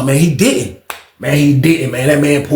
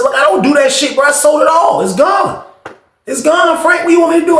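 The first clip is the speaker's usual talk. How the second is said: exasperated shouting; 12 semitones higher than usual. Retold or imitated. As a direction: shout that it's all gone. Insisting to Frank it's gone.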